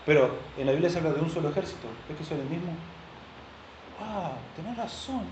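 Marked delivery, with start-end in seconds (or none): 4.0-5.3 convencimiento ingenuo. Acted. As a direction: give in softly, as if enlightened. Acts out himself being convinced. (asentir ingenuo, agudo y bajito)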